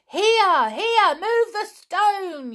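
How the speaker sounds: high, loud yell. Acted. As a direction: shout so loudly they jump. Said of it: "yelled Julian".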